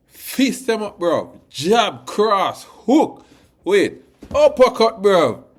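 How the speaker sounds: loud, high, punchy combo calls; hyped boxing calls. Real, not acted. Fan hyped on the win, shouting boxing combos loud and high. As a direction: bark out each punch like a fight.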